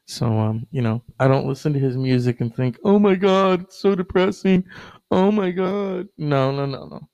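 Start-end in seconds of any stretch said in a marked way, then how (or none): 2.8-6.1 hammed-up high wailing complaint; mock dismay. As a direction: exaggerate a complainer's despair, pitched up. Mocks listeners who find music depressing.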